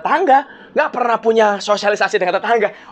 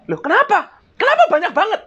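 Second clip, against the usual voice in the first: much higher, astonished questions; mock surprise; 10 semitones higher than usual. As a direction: act amazed at the extra items. Customer surprised by extra items.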